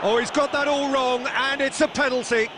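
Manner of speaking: high excited play-by-play with a shouted shot; excited commentary. Acted. Live play-by-play of an attack.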